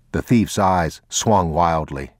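ordinary delivery

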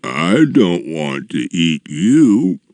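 slow, drawn-out giant voice; slow, reluctant giant; sad giant. A narrator's reading. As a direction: confess it as a sorrowful brute. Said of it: "even sadly".

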